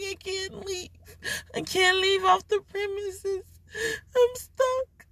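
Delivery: high, teary whine; mock sobbing, wanting to go home; high-pitched half-cry; whiny crying. Stuck at work, crying.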